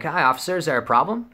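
high, sweet mock-innocent voice; fake innocent. Faking innocence to the cop.

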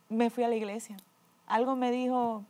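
normal talking voice

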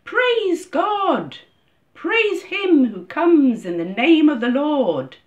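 high, loud cry of acclamation; ringing, drawn-out shouts of praise; jubilant crowd shout. A narrator's reading. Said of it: "began to shout".

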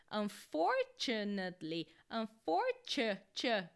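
"unfortunately" slowed down, un-FOR-chu-nate-ly; slow teaching demo of "unfortunately", t as ch; syllable by syllable. Shown as a demonstration. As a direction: break "unfortunately" up slowly, isolating the "ch". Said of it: "o jeito de pronunciar ela é".

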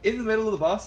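imitating someone stunned by what was said; mock disbelief. Streamer reviewing his footage, imitated disbelief. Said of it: "he burst out laughing".

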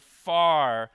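"far" said loud and punched; emphasized far. Shown as a demonstration. Preacher showing how he stressed "far". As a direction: belt out the single word "far". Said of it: "how I emphasized the word".

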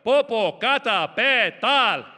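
"Popocatépetl" stretched out very slowly and loudly; slow drawn-out punchline. Acted. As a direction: drag "Popocatépetl" out while the lava comes.

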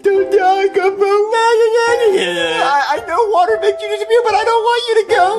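high, tearful wailing; crying. Acted. Boy begging his dying imaginary friend to stay, crying. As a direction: plead through sobs. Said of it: "stop crying".

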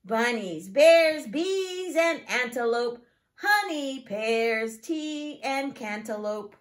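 lively, singsong picture-book reading; reading to children. Acted. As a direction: read it to a class of small children. Teacher reads picture book aloud.

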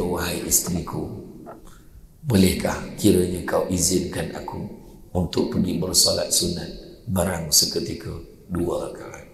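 soft, low and tender, asking the wife politely; soft reverent husband's plea; gentle poetic request. Retold or imitated. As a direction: lovingly ask leave in hushed courtly words. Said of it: "tu bahasa puitis".

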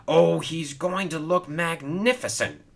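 high, proud boast; lifted, admiring brag; boastful. Acted. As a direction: show him off with pride. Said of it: "boasted Hero".

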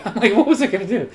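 amused, breaking into laughter; laughing. Real, not acted. Gamer who lost the train of thought, laughing. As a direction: laugh at yourself while talking.

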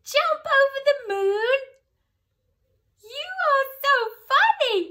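very high, loud, fast laughing squeal; laughing hen. A narrator's reading. Hen mocking the cow's moon plan, shrieking with laughter. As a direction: cackle the words out through laughter. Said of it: "Laughed the hen".

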